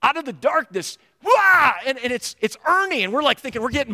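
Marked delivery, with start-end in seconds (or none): 1.2-1.7 a sudden high shouted wah; retold scare yell. A man jumping out of the dark at friends, high sudden yell. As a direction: jump out and yell wah to startle. Ernie jumps out of darkness.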